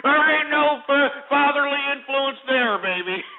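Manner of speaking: high, cackling mockery; gleeful taunt. Real, not acted. Host taunts caller about his upbringing, gleefully high. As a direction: crow it in a high voice, bursting with laughter.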